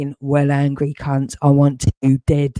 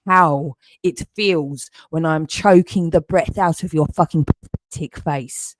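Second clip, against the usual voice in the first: usual speaking voice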